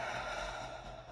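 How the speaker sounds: soft breathy sigh out; deep sigh. Real, not acted. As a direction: let out a slow sigh. Tapping release sigh.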